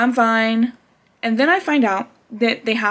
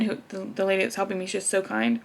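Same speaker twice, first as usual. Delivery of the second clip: ordinary delivery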